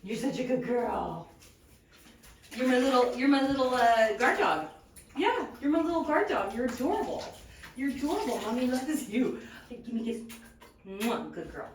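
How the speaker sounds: talking to a dog. Real, not acted. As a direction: coo over the little guard dog. Praising dog Nala.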